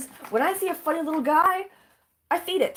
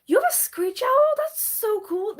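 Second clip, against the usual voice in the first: excited high squeal; 9 semitones higher than usual. Real, not acted. Excited at viewer's owl.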